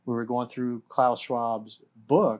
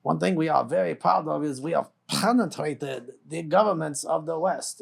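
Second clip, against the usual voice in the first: imitating Klaus Schwab's German accent; lightly mocked German accent, a bit higher; 4 semitones higher, 3 dB louder than usual. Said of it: "in his German accent".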